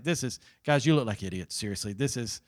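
low-key, dry mockery; understated acted taunt; mocking. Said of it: "He's just mocking them".

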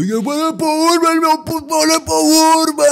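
put-on high, loud drunken chant; rowdy friends shouting. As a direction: hype it up like drunk mates. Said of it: "grupeta de cães a dizer tipo".

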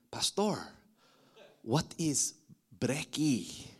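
soft, halting question to the pastor; puzzled churchgoer. Acted. Churchgoer asks the preacher about his slang, softly puzzled.

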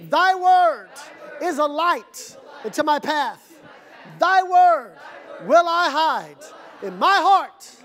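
high, projected phrases with pauses; call-and-response recitation. Shown as a demonstration. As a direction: lead a congregation's pledge, phrase by phrase. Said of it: "Repeat this after me".

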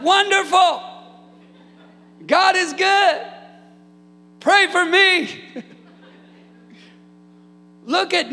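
high, loud shouted preaching; preaching at full force. Acted. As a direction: lift the room to its feet, shouting. Preaching climax.

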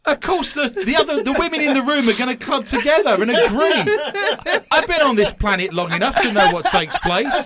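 loud laughing, high and breathy; laughing mock outrage. Real, not acted. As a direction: protest through laughter, playfully. Mock indignant host, laughing loudly.

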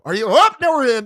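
high, loud eager question; playful high excited call; mock eager. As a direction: pitch it way up, full of excitement. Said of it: "you go".

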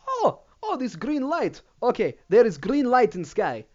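put-on very high start, broken-English Middle Eastern accent; a lightly hammed-up Middle Eastern accent. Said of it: "Middle Eastern voice".

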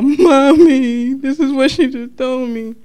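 put-on high, loud little-girl cry for mommy; high wailing child voice; childlike plea to mom. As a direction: run to mom like a hurt kid. Retelling crying to mom as child.

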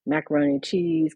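ordinary talk, nothing marked

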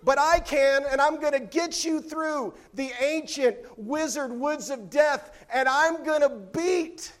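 put-on high, loud, swaggering kid voice; boastful kid Benjamin. Preacher voicing a boastful son to his siblings. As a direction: puff up like a proud little brother. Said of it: "here's what he".

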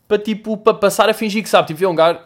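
ordinary delivery

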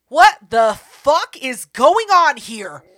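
slow, loud, stunned retelling of the moment; shocked outburst. Retold or imitated. Retold shock at the bathroom.

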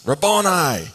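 loud, high cry of the name; cried out. Acted. Mary calling out to her teacher, a loud cry. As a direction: call his name with overwhelming joy. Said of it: "she cried out".

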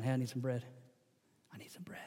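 very soft, low request fading to a whisper; tentative asking. Acted. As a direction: ask timidly, almost under your breath. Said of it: "You start asking".

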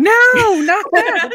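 high, loud horrified scream. Retold or imitated. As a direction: shriek it in dismay. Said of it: "I literally screamed".

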